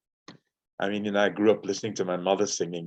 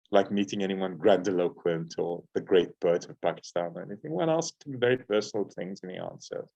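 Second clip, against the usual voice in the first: ordinary talk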